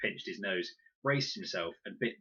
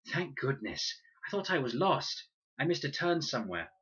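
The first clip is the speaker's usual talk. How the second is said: breathless and relieved; out of breath; 7 semitones higher, 4 dB louder than usual. A narrator's reading. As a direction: gasp it out after running. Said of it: "her breath jagged-edged".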